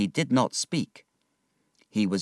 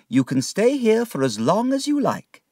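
put-on higher, gentle old aunt voice; kind aunt; 13 semitones higher, 4 dB louder than usual. Aunt welcoming David to stay, kindly. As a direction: welcome the boy in.

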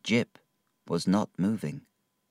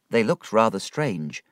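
ordinary delivery, nothing marked

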